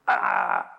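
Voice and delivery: short low cry of pain, imitated; a sudden pained shout cut off; retold scream. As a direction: echo of a shot man's last cry. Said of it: "y un grito".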